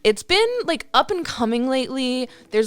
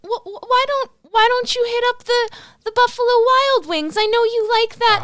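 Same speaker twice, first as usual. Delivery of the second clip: high, sweet motherly coaxing; a doting mom nudging her homebody son to go out, high and sweet; 12 semitones higher than usual. Acted. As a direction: coo at her grown son like a fussing mother. Ma Tucker suggests wings.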